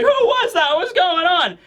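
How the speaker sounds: very high, panicky questions; freaked out. Retold or imitated. Cypher Pole agents panicking after the gunshot, high frightened squeal. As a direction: squeal it like you're terrified.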